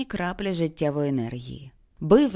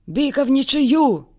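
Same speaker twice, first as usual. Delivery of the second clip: higher and louder, a call from the crowd; distant call; 8 semitones higher, 5 dB louder than usual. A narrator's reading. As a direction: call out from across the bridge. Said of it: "долетіли до Мартіна слова".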